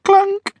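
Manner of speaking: very high comic clunk sound. Pretend. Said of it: "stop doing it".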